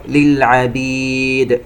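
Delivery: chanted "للعبيد" with bounced final d; qalqala. Shown as a demonstration. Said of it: "ونقلقل دال". (قلقلة دال "للعبيد")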